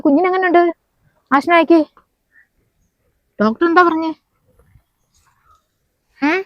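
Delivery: high, loud and agitated, calling down the phone; upset mother-in-law shouting down the phone about the baby; alarmed shouting on phone. Acted. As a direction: shout your worry into the receiver.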